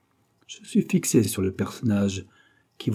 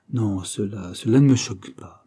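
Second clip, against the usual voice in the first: usual speaking voice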